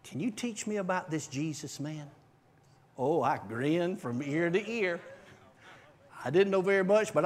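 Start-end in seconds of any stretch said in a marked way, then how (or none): 0.0-2.1 quiet request. Retold or imitated. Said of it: "he whispered to me".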